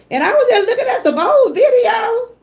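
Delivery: high, laugh-crying with joy; overwhelmed, laughing and near tears at once; laughing through tears. Real, not acted. Streamer laugh-cries to her chat about getting monetized. Overjoyed at being remonetized.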